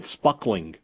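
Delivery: "spuckling", first vowel shortened to uh, no R; slowly mimicked "spuckling"; mangled sparkling. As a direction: imitate a muddled "spuckling" for sparkling. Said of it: "at best, he said spuckling".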